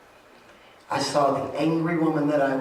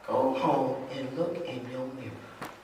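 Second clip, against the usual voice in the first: ordinary delivery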